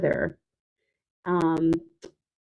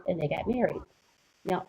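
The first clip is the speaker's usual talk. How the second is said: usual speaking voice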